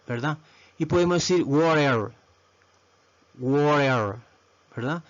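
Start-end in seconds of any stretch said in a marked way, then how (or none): 1.4-2.1 WATER with a tapped R in place of T; water with R sound. Shown as a demonstration. Teacher showing students the R option, water with tapped R. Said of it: "Podemos usar la R". 3.4-4.2 WATER drawn out with R for T; water with R sound. Shown as a demonstration. Teacher repeating the model word, water with R for T. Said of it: "Podemos usar la R".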